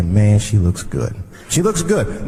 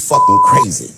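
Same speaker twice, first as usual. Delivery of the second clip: outraged yell; 5 dB louder, faster than usual. Real, not acted. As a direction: explode on the punchline. Outraged punchline.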